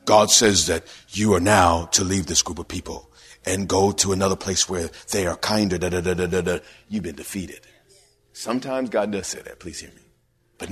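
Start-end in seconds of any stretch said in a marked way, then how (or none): 0.0-7.6 a hint of a low, solemn false god voice; lightly mock a fake divine voice, much lower; devil posing as God. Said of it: "he speaks to them like God".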